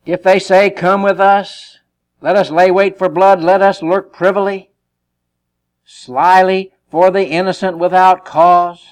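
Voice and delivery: loud, slow, emphatic reading; forceful scripture reading. Acted. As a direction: proclaim the verse slowly and heavily.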